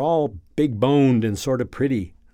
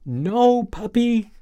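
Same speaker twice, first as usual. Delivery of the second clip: nearly crying; 10 semitones higher, 4 dB louder, slower than usual. Acted. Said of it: "This comes out as a cry nearly".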